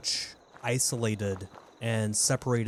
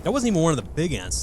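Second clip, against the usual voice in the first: louder and faster repeat; 5 semitones higher, 5 dB louder, faster than usual. Real, not acted. Repeats it louder after "What?.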